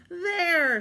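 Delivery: high, loud cry from the top of the pile; triumphant shout. Acted. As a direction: crow it out in victory. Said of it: "shouted Pig".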